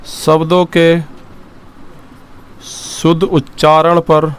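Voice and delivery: शुद्ध उच्चारण पर said very slowly, word by word, for writing down; slow dictation. Shown as a demonstration. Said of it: "लिखेंगे".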